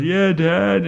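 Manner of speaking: imitating a crying daughter, pitched high; high, lightly voiced upset little girl; tearful child. Crying daughter answers dad from upstairs, mock tearful. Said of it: "and she's crying".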